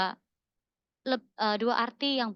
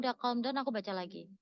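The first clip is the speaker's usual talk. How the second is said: normal talking voice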